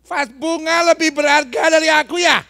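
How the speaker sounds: loud, high-pitched acted outburst; indignant retort. Child talking back to an angry mother, mock indignant shouting. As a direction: hurl it back like an offended child.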